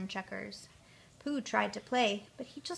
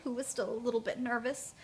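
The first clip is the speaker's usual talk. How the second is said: plain reading voice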